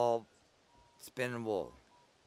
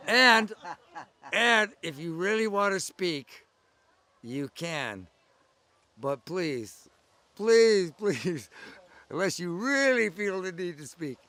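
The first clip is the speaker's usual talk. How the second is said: higher and louder, a hammed-up plea; pleading, building up; 6 semitones higher, 8 dB louder, slower than usual. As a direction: beg her, each please climbing louder. Retelling his plea to Jane.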